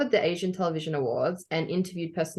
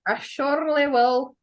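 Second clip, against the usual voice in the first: a playful, higher Irish lilt; Irish accent; 8 semitones higher, 3 dB louder than usual. Pretend. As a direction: slip into a mock Irish accent. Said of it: "I don't know why I'm suddenly Irish".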